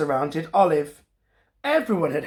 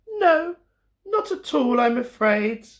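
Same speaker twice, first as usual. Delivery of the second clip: mild sigh; 6 semitones higher than usual. Acted. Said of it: "Olive sighed".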